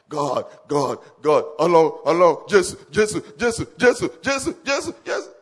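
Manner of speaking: hammered-up shouted pleading, pitch rising to a cry; mock frantic prayer. Mocking fasting believers, a loud desperate prayer. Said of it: "you people will keep fasting and praying".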